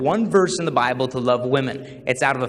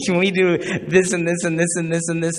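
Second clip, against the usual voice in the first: put-on eager teen; 2 semitones higher than usual. Acts teen listing favors eagerly.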